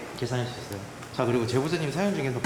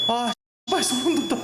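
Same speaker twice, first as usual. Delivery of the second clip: high shocked swearing; startled cursing; 11 semitones higher, 3 dB louder than usual. Real, not acted. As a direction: curse in sudden fright. Startled by sudden broadcast.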